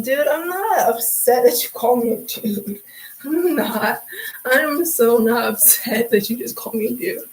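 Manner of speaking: ordinary talk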